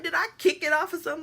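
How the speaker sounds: high, loud and fast; fast panicked kid; frantic question. Retold or imitated. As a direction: rush it out, worried. Searching bed.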